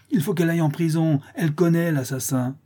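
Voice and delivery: high-pitched accusing clamour; crowd crying out. A narrator's reading. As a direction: clamour for her punishment. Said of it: "s'écriaient les laboureurs". (clameur accusatrice, aiguë)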